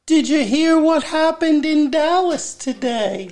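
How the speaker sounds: high, eerie ghost voice. Retold or imitated. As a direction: call it out like a spirit from a far hill. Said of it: "you have to do it with the voice".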